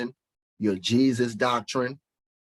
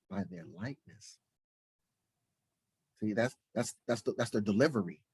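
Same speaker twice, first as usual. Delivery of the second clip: normal talking voice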